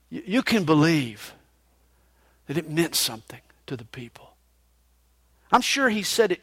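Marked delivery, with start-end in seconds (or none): 2.5-4.3 fast hushed whisper. Real, not acted. Preacher whispering to the congregation for weight. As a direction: lean in and whisper it.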